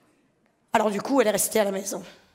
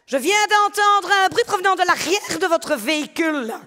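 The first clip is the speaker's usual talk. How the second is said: put-on a lightly mixed Belgian-Canadian accent, a bit higher; cop accent; 6 semitones higher, 3 dB louder than usual. A cop stopping the father, in a mixed Belgian-Canadian accent. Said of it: "c'est un mélange de Knokke-le-Zout, euh, Aix-la-Chapelle et, et Canada".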